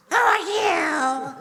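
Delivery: high, drawn-out wail of alarm; alarmed cry. Acted. Freed captive demands the stranger's name, high alarmed cry.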